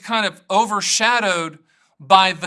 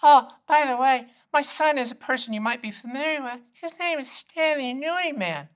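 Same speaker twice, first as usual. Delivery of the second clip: put-on higher elderly mom, smugly dropping a name; 6 semitones higher than usual. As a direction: play the mom telling off a salesman. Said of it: "And she'll go".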